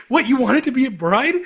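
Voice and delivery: high, loud incredulous question; mock disbelief. Retelling friends' reaction to Megu's confession, mock disbelief. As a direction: exclaim it in hammed-up astonishment.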